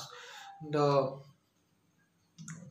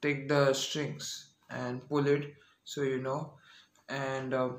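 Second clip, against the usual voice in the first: normal talking voice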